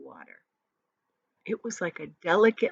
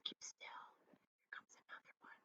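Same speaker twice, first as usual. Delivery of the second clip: soft, tense whisper; hushed urgent whisper; 32 dB quieter than usual. Acted. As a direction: whisper so the bird won't hear. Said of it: "whispered James".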